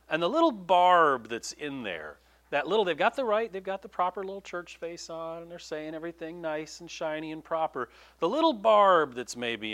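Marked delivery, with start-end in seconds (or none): none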